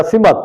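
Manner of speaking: سمت in two syllables, "si-mat"; غلط تلفظ. Shown as a demonstration. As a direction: say سمت as "simat". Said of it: "تو یہ بھی غلطی ہے". (سمت، سین کے نیچے زیر، میم پر زبر)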